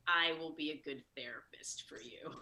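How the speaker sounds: hushed whisper, as if breaking bad news softly. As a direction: breathe the line almost voiceless, imitating the therapist. Therapist gently dumps her.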